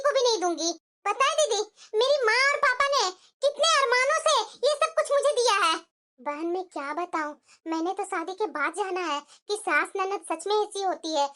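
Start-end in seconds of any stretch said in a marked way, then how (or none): 6.2-11.3 a touch lower and softer, weary sister-in-law; lightly voiced elder sister-in-law. Elder sister-in-law complains to the younger, lightly voiced, a touch lower and softer. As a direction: sigh it out to a fellow sister-in-law.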